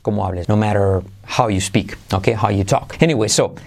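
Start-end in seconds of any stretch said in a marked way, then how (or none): none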